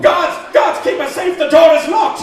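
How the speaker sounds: high, loud frantic calls; panicked shouting. Acted. Husband shouting to the guards outside, frantic yell.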